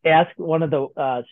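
ordinary talk, nothing marked

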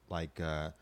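ordinary talk, nothing marked

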